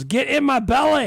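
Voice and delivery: loud, high Fat Bastard Scottish shout; Scottish accent. Retold or imitated. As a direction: bellow it in a hammy Scottish accent. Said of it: "you did a Scottish accent earlier".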